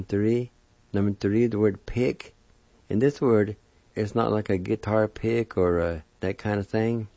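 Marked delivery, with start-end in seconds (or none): none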